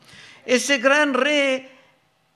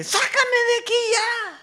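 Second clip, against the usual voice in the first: pitched way up, a desperate plea; panicked cry; 12 semitones higher than usual. Pretend. Us crying out to God from the pit, pitched-up panicked plea. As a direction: cry out to be rescued right now. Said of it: "gritaríamos nada más que".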